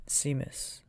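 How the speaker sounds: usual speaking voice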